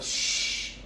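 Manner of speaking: long, soft "shh"; reenacted silencing shush. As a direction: hush a chattering crew. Silencing questioners.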